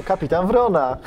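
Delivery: loud, high gleeful punchline. Real, not acted. Host answering a joking question, loud and gleeful. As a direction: deliver the joke name with glee. Joke answer, laughter follows.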